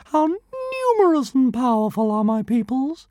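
high, grand, boastful little dragon voice; joyful tiny dragon. A narrator's reading. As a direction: swell with pride like a tiny tyrant. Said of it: "announced Zigarastica joyfully".

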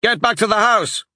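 high-pitched urgent order; father's shout. A narrator's reading. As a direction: call the others to retreat. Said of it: "Ty's father shouted to the others".

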